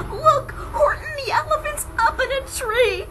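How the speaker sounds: very high, loud mocking friends' voice; gleeful taunting shout. Friends taunting Horton in the tree, high gleeful shout. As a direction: jeer at him with glee. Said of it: "shouted with glee".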